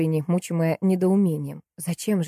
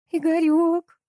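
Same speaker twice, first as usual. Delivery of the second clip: high, drawn-out wail of a name; howling; 11 semitones higher, 4 dB louder, much slower than usual. A narrator's reading. Salesgirl howls for her husband, high wail. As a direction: howl the name miserably. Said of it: "выла девчонка".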